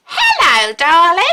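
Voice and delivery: high, loud fond hello; playful high greeting; sing-song greeting. Acted. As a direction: call it out like a cheerful bird. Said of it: "All together".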